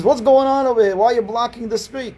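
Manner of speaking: high, loud angry neighbor yelling; retold shouting. Neighbor storms in yelling about the blocked street, loud. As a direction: bark it at the wedding crowd. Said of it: "was shouting".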